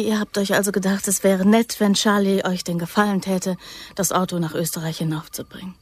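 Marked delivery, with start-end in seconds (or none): 3.9-5.7 soft lonely whisper. Acted. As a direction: whisper for comfort. Frightened plea for comfort.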